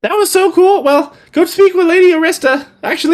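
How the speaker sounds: put-on high-pitched twelve-year-old boy; Piper. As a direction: pitch it up as a small kid.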